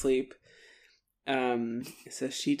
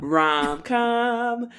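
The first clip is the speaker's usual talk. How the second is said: high, drawn-out announcement; playful singsong; 9 semitones higher, 3 dB louder than usual. Pretend. Co-host echoing the rom-com joke, playful singsong.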